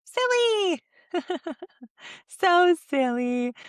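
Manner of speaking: high, giggly playful voice; high laughing silly. Shown as a demonstration. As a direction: giggle it out for the baby.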